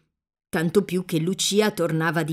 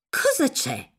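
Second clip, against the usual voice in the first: gently anxious mother asking; understated anxious question to daughter; mildly anxious; 6 semitones higher than usual. A narrator's reading. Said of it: "domandò di nuovo ansiosamente".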